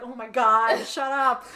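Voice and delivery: loud exasperated retort; mock exasperation. [name] answers Jordan's shower jibe, exasperated. Acted retort to teasing friend.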